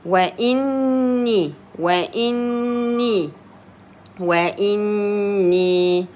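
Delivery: slow وإني with a long hummed double n; doubled N. Shown as a demonstration. Teacher shows what two N means, drawing out the n in وإني. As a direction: hold the n of وإني level before the final vowel. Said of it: "That means I give two N".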